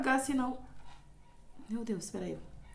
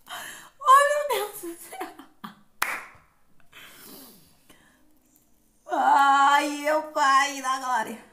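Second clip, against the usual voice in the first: laughing cry of pain; 6 dB louder, slower than usual. Real, not acted. Peeling the mask off, laughing wail of pain. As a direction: laugh and wail at once as it rips. Painful peel.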